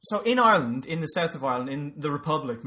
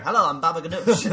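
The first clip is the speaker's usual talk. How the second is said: lightly voiced cabaret crooner, a bit louder; Baba Ganoush lounge singer; 6 dB louder than usual. Middle Eastern lounge singer greets the audience, lightly hammed-up. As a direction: introduce yourself like a cheesy lounge act. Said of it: "lounge singer".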